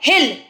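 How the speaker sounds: "हिल" with a short i; brief vowel in "हिल". Shown as a demonstration. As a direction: say "हिल" quickly. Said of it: "छोटी आवाज़ आ रही है".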